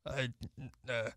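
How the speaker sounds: teasing low nasty-old-timer imitation; Dave impression. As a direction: grumble back in mockery. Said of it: "Oh, I'm Dave".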